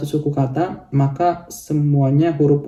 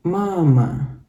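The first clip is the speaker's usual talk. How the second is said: "mama" with the first "ma" pitched up; stress on first syllable; 2 semitones higher than usual. Shown as a demonstration. Teacher showing learners Russian stress, first syllable raised. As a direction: lift the first syllable of "mama", let the second fall. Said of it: "penekanannya di huruf A atau di suku kata yang pertama".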